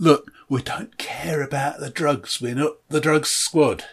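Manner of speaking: slow, hushed, fed-up copper; a tired street detective under his breath; weary detective. Acted. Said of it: "street detectives who have had enough".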